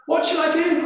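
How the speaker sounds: high and loud, calling out in fear; man on a cliff calling up to the voice above; anxious call. Acted. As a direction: cry out for instructions. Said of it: "called the man".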